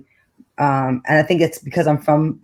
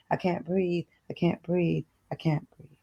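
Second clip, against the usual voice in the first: "I can't breathe" fading softer each time to a near whisper; fading to nothing; 11 dB quieter than usual. Acted. Refrain fading to the end.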